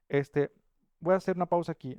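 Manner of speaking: ordinary delivery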